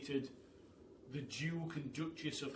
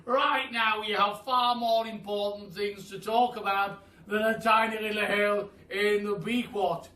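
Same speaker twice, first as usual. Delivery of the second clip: higher, loud, booming praise; old Duke praising the animals, loud and higher; 7 semitones higher, 12 dB louder than usual. A narrator's reading. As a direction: hail them like a grand old duke. Duke voiced in the story.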